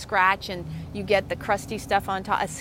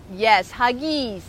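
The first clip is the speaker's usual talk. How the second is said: pet talk; 4 semitones higher, 6 dB louder, slower than usual. Real, not acted. Asking dog for hugs.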